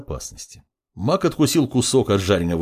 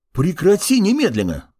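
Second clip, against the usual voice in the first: sharp, high outburst; flaring up; 10 semitones higher than usual. Acted. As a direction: snap at him, suddenly alarmed. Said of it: "взвился Романс".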